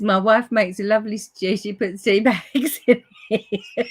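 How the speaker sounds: real laughter spilling into the last words; laughing read-out. Real, not acted. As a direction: read it out while cracking up. Reads viewer's comment while cracking up.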